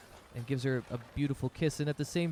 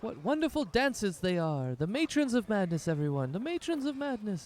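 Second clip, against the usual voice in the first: rally the crowd like a stage host; hyped announcer; 8 semitones higher than usual. Acted. Dancer hyping the crowd after the show, high announcer call.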